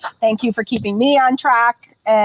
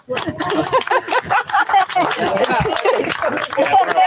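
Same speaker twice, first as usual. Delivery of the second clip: laugh; 8 semitones higher than usual. Real, not acted. Teasing team behind the scenes.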